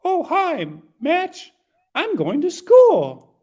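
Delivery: put-on sweet, innocent little puppet kid; Kermie-style sweet kid; Kermit-like voice. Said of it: "a little Kermie voice".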